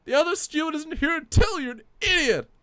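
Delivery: high put-on Southern colonel puppet voice; Puppet Foley voice. Puppet complains to the stream chat in a Southern accent. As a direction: play the indignant puppet with a Kentucky drawl.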